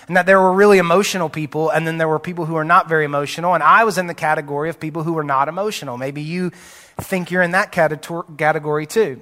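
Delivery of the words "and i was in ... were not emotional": normal talking voice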